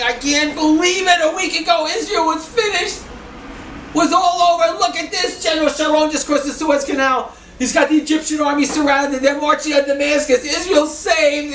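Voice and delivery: high, loud overjoyed exclamation; crying with joy at the news; loud happy tears; retold tearful joy. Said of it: "Walter Grunfeld's crying".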